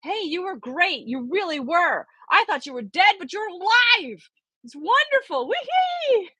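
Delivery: Johnny cheering the professor after the rock slide; excited boy yelling. A narrator's reading. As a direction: burst with joy, ending in a squeal. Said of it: "excitedly ... he yelled".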